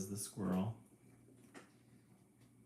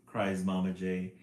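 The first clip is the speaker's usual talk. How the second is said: plain reading voice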